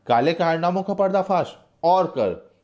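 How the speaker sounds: high-pitched mocking taunt from a cruel captor; sneering villain. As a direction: spit the taunt up high while beating him. Villain beats prisoner while taunting. (कैदी को पीटते हुए ऊँची, ताना मारती क्रूर आवाज़)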